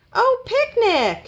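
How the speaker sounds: high, drawn-out happy exclamation; delighted. A narrator's reading. Rabbit delighted by the picnic.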